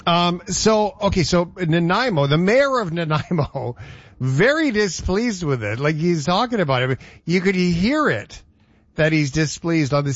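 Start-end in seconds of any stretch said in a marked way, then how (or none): none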